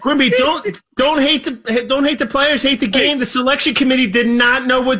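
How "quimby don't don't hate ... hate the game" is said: high-pitched plea. Acted. Pleading with a high voice.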